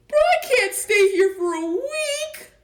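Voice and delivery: high, loud tearful cry; tearful wail. Acted. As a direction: wail at the thought of a week. Pooh dismayed, crying.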